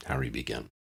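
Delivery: usual speaking voice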